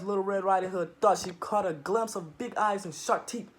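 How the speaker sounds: ordinary delivery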